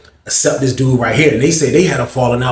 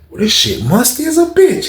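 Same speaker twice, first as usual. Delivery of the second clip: mock cellmate voice; 11 semitones higher than usual. Retelling cellmate's reaction.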